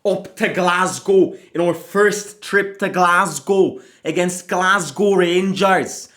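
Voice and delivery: put-on loud, upbeat Scottish accent greeting; hearty Scottish accent, like a Glasgow local. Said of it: "don't mind my Scottish accent".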